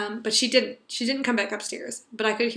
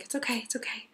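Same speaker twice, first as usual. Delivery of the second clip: soft whispered reassurance; hushed soothing; 10 dB quieter than usual. Retold or imitated. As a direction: hush a crying toddler gently. Soothing crying child, retold.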